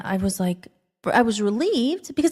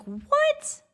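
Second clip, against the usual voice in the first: squeaky astonished what; shocked high what. Retold or imitated. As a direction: pitch it way up in disbelief. Retelling mind being blown.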